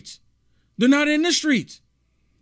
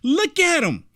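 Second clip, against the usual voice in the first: ordinary delivery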